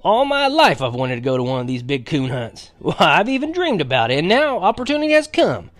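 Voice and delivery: put-on loud, high, excited old grandpa; almost shouting. A narrator's reading. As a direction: burst out with rising excitement. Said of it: "and almost shouted".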